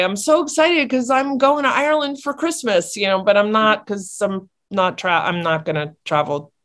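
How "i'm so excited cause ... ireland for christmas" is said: a lightly higher, bubbly pretend thrill; playful put-on excitement; mock excitement. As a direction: gush about a trip that isn't happening. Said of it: "I wish I could say".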